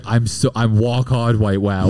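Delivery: R's turned into W's, drawn out; slow toddler-style speech with W for R; baby talk. Retold or imitated. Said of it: "still talked like a baby".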